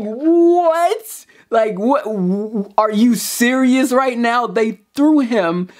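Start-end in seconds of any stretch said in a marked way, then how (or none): 0.0-4.5 stunned disbelief. Retold or imitated. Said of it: "you're like".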